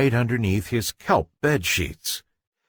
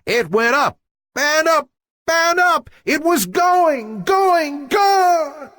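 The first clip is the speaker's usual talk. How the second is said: climbing higher with every going; rising excitement; 4 dB louder than usual. A narrator's reading. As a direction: build the suspense as the ball soars. Ball flying away.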